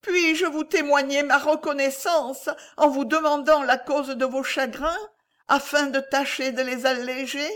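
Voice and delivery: high, plaintive lady asking tenderly after his sorrow; plaintif. A narrator's reading. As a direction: plead in a high lamenting voice. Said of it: "dit la dame d'une voix plaintive". (d'une voix plaintive et aiguë)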